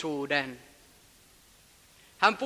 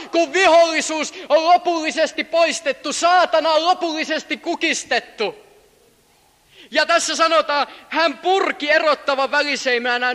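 loud, higher sermon climax; fiery preaching; 6 semitones higher, 7 dB louder than usual. Acted. Preacher proclaiming the undying dream, full force. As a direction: build the vision like a revival.

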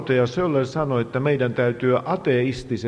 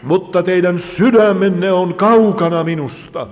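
loud, raised interpreter's preaching voice; thundered scripture quote; 7 semitones higher, 10 dB louder than usual. Retold or imitated. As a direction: echo the rebuke at full force. Interpreter matches quoted accusation. (voimalla julistettu syytös)

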